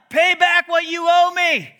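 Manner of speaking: loud high demand, full force; yelling. Acted. Servant choking his debtor for repayment, yelling. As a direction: yell it slowly, every word held. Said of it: "But you're yelling".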